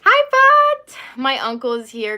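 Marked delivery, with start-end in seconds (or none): 0.0-1.0 high, loud happy greeting; calling out. Real, not acted. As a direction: call out to the uncle across the room. Uncle arrives, calling out.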